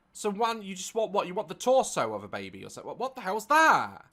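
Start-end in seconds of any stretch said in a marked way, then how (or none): 2.9-4.0 incredulous. Real, not acted. Incredulous reaction to the clip.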